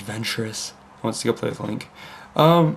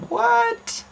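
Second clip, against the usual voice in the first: baffled what. Retold or imitated. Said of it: "It's just like".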